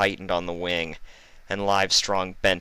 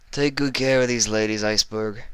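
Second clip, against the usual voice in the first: oily, higher mock-kind villain; sly doctor voice; 5 semitones higher than usual. Livestrong taunting the injured Fluttershy, oily and sweet. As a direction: coo falsely sweet like a sadistic doctor.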